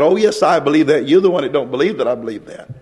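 ordinary talk

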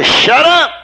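high-pitched, emphatic cut-off; forceful rebuke. Acted. Preacher silencing someone buzzing gossip in your ear, forceful rebuke. Cutting off a gossip mid-sentence.

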